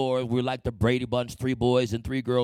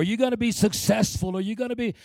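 higher mock-encouraging voice; teachers' praise; 8 semitones higher than usual. Teachers promising success, higher, encouraging. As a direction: keep the praise going.

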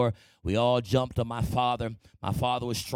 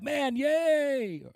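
much higher squeaky little cheer; a kid's thrilled yay; childish glee; 13 semitones higher than usual. Retold or imitated. Boy reacting to teachers' praise, much higher cheer. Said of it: "I was like".

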